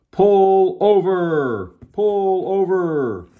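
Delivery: high, loud, long-drawn bullhorn call; bullhorn shout. A narrator's reading. Officer ordering the driver to stop, bullhorn shout. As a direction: stretch each word out as if through a bullhorn. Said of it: "He shouted into his bullhorn".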